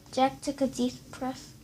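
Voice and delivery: ordinary delivery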